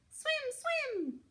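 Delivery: very high little gosling saying a name; high, small baby goose; tiny gosling voice. A narrator's reading. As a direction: chirp it out like a baby bird. Gosling excited to swim.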